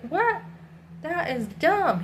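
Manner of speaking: high-pitched mock disbelief, then drawn out; incredulous. Woman retelling a guy's reaction to her refusal, incredulous.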